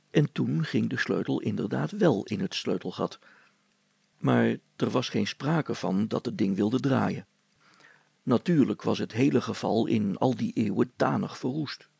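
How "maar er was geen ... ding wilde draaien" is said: usual speaking voice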